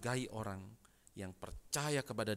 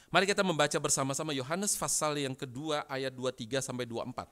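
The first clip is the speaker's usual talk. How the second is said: loud, projected call to the congregation; rally a crowd to read along; preacher's call to read; 4 semitones higher, 7 dB louder than usual. Real, not acted. Preacher inviting the church to read Yohanes together, projecting loudly.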